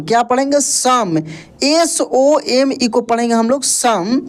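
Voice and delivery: ordinary delivery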